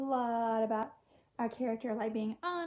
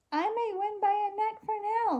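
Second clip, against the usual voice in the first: laughed; 7 semitones higher than usual. A narrator's reading. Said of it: "laughed the giraffe".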